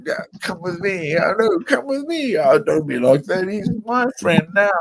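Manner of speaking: eager friendly local. Retold or imitated. Pub local voiced in story.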